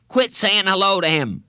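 high, loud schoolboy order; indignant kid's demand; annoyed boy. A narrator's reading.

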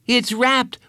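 put-on high, excited boy's exclamation; excited and urgent, high-voiced; exclaiming. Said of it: "Bradley exclaimed".

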